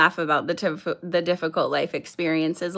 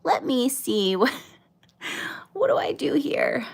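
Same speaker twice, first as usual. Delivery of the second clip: hushed, higher mock-confused mumble to herself; imitating herself as a baffled noob, half-whispered; clueless newbie; 5 semitones higher than usual. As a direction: play the lost beginner fumbling with buttons. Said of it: "I'm hopping on live like".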